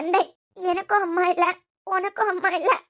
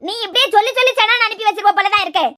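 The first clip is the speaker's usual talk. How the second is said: loud, exasperated snapping back; angry retort; 7 dB louder than usual. Acted. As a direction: fire it back at him in anger. Mother angrily retorts to son.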